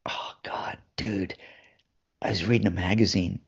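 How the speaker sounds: near-whisper, embarrassed admission; hushed confession. Retold or imitated. Actor quietly confessing to the director that he read a magazine. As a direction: confess it under your breath.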